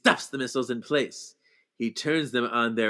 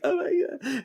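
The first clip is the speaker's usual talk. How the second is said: a much higher oh my God; stunned gasp; 13 semitones higher, 3 dB quieter than usual. Real, not acted. Reacting to the tearful breakup scene, stunned gasp. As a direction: react like the scene floored you. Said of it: "I'm like".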